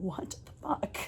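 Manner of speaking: breathy whispered what the; whispered disbelief. Retold or imitated. Telling Vicky silently, in a whisper. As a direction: mouth it under your breath.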